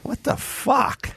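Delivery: high breathy mock outrage. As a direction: fake shock at parents.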